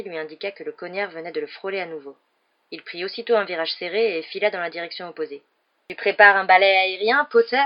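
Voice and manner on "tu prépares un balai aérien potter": loud, raised jeer; shouted over distance at a rival; taunting shout. A narrator's reading. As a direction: call it out across the pitch. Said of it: "cria Malfoy".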